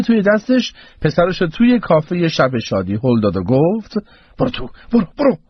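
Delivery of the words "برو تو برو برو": high, hurried urging; urgent order. Acted. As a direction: shoo your son inside urgently. Mother shoves son inside.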